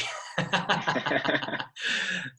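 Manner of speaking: short real laugh. Real, not acted. Host laughing warmly at the guest's answer. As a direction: laugh freely at the remark.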